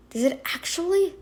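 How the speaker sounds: ordinary talk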